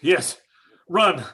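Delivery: ordinary delivery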